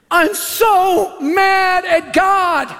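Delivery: mock crying wail, drawn out and high; tearful outburst. Grieving woman to a stranger in the store, tearful wail. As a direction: wail it slowly, as if bursting into tears. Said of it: "she burst in tears".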